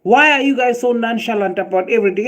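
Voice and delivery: ordinary talk, nothing marked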